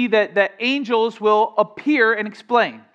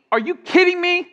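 high incredulous "are you kidding me"; 7 semitones higher than usual. Shown as a demonstration. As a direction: toss it up high in disbelief. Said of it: "only appropriate response".

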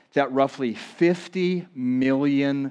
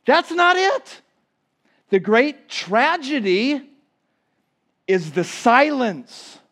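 higher, building emphatic sermon; rising preaching climax; 10 semitones higher, 4 dB louder than usual. Acted. Preacher naming the church's true tragedy, building.

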